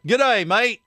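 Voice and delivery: high-pitched, hammed-up Aussie greeting; terrible Australian accent. Shown as a demonstration. Host greeting his guest Terry in a bad Australian accent. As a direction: badly fake an Australian accent. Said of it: "I do a terrible Australian accent".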